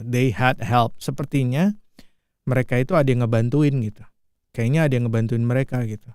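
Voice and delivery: ordinary talk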